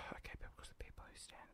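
whispering. Real, not acted. Exasperated aside, whispering.

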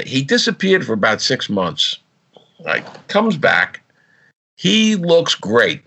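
ordinary talk, nothing marked

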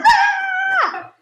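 high-pitched retold shriek of fright; startled scream. Wife startled by husband in the bathroom, startled scream. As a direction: act out a jumpy, startled scream. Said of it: "I startle very easily and I scream".

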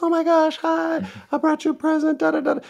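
mock super-nice woman, very high voice; Christina V. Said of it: "she's the nicest out of all of them".

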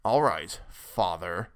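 old son playing the obedient child; mock filial respect. A narrator's reading. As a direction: feign respect for father, hammed-up. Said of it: "a grotesque simulation of filial respect".

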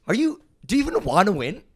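high, loud demanding coach mom; mock intense mom. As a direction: snap at the team in a timeout. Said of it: "she just goes".

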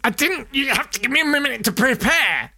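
high, strained plea; winded Houdini. Retold or imitated. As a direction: gasp it out, still hurting. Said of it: "he said".

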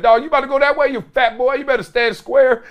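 taunting the fat lineman from across the line; calling out. Retold or imitated. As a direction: holler across the line of scrimmage.

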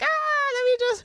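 flustered with excitement; squeaky excited stammer; giddy voice. Retold or imitated. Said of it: "I was like".

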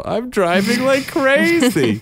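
mock wild driver. Wife teasing husband about his driving, high hammed-up voice. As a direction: ham it up like a maniac at the wheel.

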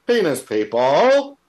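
loud, slow Aussie mock shout; Australian impression. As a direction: ham up the Aussie bloke. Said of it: "that's a great impression".

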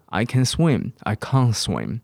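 "can't" with a long ah set against a short "can"; British "I can't swim" with a broad vowel; British contrast. Shown as a demonstration. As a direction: make "can't" sound clearly different from "can". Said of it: "英式英文就差很多".

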